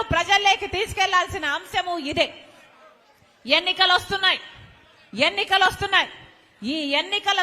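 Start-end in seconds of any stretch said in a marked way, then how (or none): 3.5-4.4 calling out to the crowd. Real, not acted. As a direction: announce it like a rallying cry. Rally speech, repeated warning. (గట్టిగా పిలుపు)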